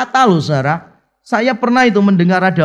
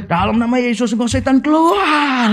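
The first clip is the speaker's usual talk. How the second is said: mock exorcism command; 5 semitones higher than usual. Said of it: "teriaknya kencangnya setengah mati".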